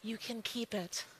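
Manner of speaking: hushed, settled "You can keep it"; soft, calm letting go. Retold or imitated. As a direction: quietly release it twice. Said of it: "I said".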